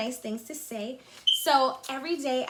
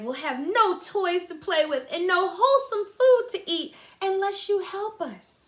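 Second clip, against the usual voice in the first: a touch of high pleading; pleading toys; 6 semitones higher, 6 dB louder than usual. Acted. Toys pleading with the engine, mildly high voice. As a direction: ask earnestly for help. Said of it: "toys pleading with engine".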